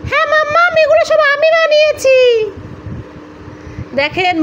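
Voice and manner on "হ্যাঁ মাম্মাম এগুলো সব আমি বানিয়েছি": put-on high, louder proud little boy; child voice. Little son showing mummy his rotis, in a high proud child voice. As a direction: show off like a proud toddler.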